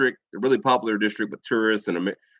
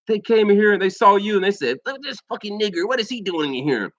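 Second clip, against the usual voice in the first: raised, high-pitched retold outrage; mock indignation; 9 semitones higher than usual. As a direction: imitate an outraged witness quoting a bigot. Acted report of racist outburst.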